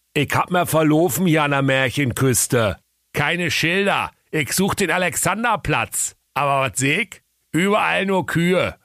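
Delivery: chatty Berlin bear; Berlin accent. A narrator's reading. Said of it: "trottliger Bär und sagte".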